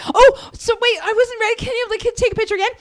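high-pitched little voice; retold flustered plea. Fan asking photographer for another picture, high flustered plea. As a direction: plead high, imitating your flustered self.

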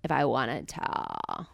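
slow, low creaky fry; vocal fry. Shown as a demonstration. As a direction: drawl it in a crackly fry. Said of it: "can you always do it?".

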